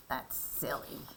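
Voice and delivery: usual speaking voice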